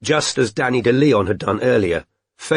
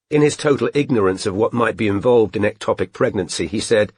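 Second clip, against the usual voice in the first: ordinary delivery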